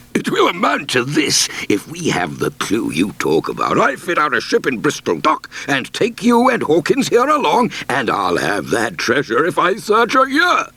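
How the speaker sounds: squire's excited, bluff voice, running on with plans; eager squire. Acted. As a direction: voice the eager squire dreaming of treasure. Squire's voice in story.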